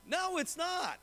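normal talking voice

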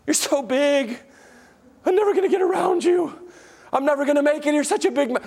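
hammed-up helpless whimper to the mountain; whiny defeated believer. Believer whines to the mountain about its size, mock helpless. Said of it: "we go, Oh, Mister Big Mountain".

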